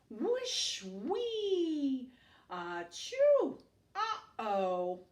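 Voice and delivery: put-on high, loud storybook noises for the kids; playful sound effects. A narrator's reading. Reading a picture book to kids, lively playful sound effects. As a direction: ham up each noise as it happens.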